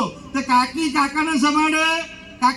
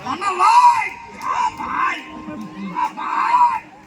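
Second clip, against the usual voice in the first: much higher, calling shout; mock shrieking wife; 7 semitones higher, much slower than usual. Wife with kids shouting for husband, high mock yell. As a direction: yell across the house at him. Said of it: "છોકરું એકાદું વળી જાય".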